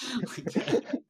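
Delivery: high breathy odd delivery; Pug impression. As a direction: imitate a weird-talking man. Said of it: "And he does".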